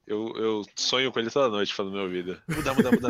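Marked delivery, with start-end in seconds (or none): 2.5-3.1 high, rapid-fire repeated cry; mock battle cry through laughter; Dio dub imitation. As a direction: imitate an over-the-top anime villain. Imitates the Dio dubber's catchphrase.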